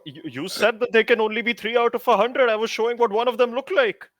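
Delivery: defensive protest. Acted. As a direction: argue back to the teacher, affronted. Student defends self to teacher.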